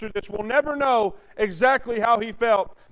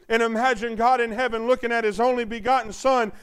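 usual speaking voice

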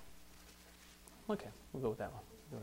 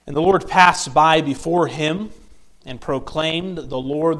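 slow, measured Bible reading; solemn, emphatic reading from the pulpit; scripture reading; 5 dB louder than usual. Real, not acted. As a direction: proclaim the verses deliberately to a congregation. Reads scripture aloud.

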